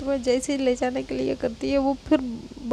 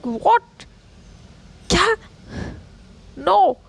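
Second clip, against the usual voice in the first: shocked disbelief; 5 semitones higher, slower than usual. Retold or imitated. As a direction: recoil in outrage. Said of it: "तो कहती".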